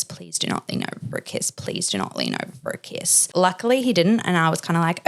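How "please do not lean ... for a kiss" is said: whispered, urgent inner prayer; hushed repeated plea, fading lower. Retold or imitated. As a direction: whisper it to yourself, begging. Silent plea repeated in head.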